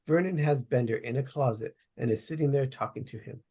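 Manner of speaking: normal talking voice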